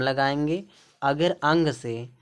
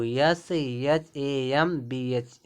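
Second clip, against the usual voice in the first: slow letter-by-letter spelling; much slower than usual. Shown as a demonstration. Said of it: "जैसे शंभू".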